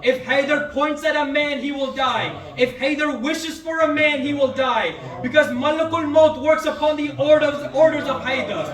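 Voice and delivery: loud, high, rising declaration of power; thundering proclamation. Acted. Preacher voicing Imam Ali, thundering back at Marhab's taunt. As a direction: hurl each line at the enemy, hammering he will die.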